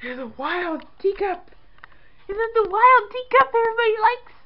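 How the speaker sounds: high cooing pet voice. Real, not acted. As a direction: coo at the teacup kitty. Cooing at the cat.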